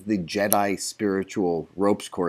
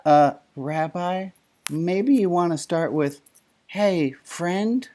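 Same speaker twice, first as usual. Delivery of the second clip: gentle suggestion; 6 semitones higher, slower than usual. Retold or imitated.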